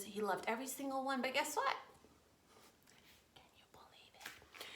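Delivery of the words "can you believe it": hushed disbelief; soft conspiratorial whisper. Acted. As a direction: drop to a secret whisper. Dramatic hush before surprise.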